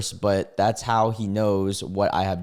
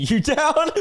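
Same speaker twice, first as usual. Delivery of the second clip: laughing mid-quote; 3 dB louder than usual. Real, not acted. Laughs while reading comment.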